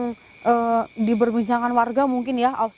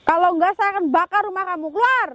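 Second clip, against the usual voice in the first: angry shout; 8 semitones higher than usual. Acted. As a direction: yell it, threatening and furious. Threatening the spirit to leave.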